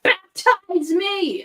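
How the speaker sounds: high, very drawn-out cry; hammed-up, stretched plea from the stage; mock exclamation. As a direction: call it out slowly like an eager convert. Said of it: "walk on stage and be like".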